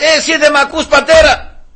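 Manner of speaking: high, insistent hail; calling out. Acted. Hailing a stranger who doesn't respond, calling out. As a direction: call after someone who isn't listening. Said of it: "του φωνά".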